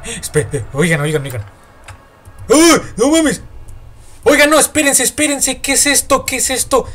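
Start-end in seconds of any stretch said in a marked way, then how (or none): 2.5-3.4 high, loud cry of shock; startled yelp. Real, not acted. Player jolted by the game, startled yelp. 4.2-6.9 high-pitched alarmed pleading; panicked. Real, not acted. Player finds something in the entrance, panicked.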